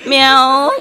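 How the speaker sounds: imitated meow, loud and laughing. Man joking about a bilingual cat, laughing meow. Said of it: "bark at me".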